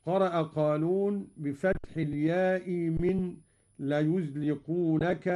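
normal talking voice

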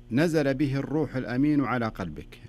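lightly chanted quote of the verse; recited verse. Retold or imitated. Sheikh quotes the caller's verse, lightly chanted. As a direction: recite the verse with a pause after it. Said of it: "ليش الوقفة هذه؟".

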